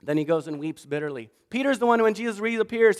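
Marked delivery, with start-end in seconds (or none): none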